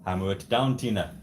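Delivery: put-on loud, forceful African-accented commands; hammed-up African accent, mustering the troops. Husband urging Tina to hammer, loud mock African accent. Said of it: "I use my African voice".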